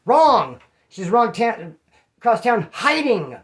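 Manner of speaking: loud, high one-word verdict; emphatic shouted correction. Real, not acted. Answering his own question about the ex-wife, loud emphatic wrong. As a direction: slam the answer down hard.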